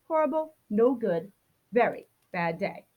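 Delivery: ordinary delivery, nothing marked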